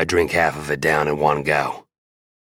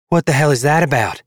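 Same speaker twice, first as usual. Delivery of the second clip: high, cold, louder woman's voice; frosty tone; 11 semitones higher, 5 dB louder than usual. Acted. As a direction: ask it icy and demanding. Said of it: "in a frosty tone".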